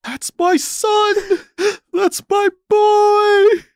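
high, drawn-out wailing; hammed-up grief, very high and stretched; anguished father's wail. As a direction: wail it out like a heartbroken movie dad. Acted grieving dad in film scene.

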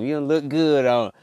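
ordinary delivery

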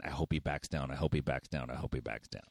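a fearful murmur that dies away, repeated; inner fearful thought, fading. Retold or imitated. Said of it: "they're thinking".

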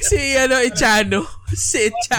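loud, high laughing name-drop. Real, not acted. Friends remembering their high school teacher, laughing loudly.

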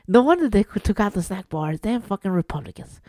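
usual speaking voice

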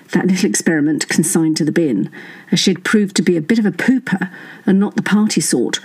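usual speaking voice

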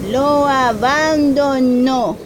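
usual speaking voice

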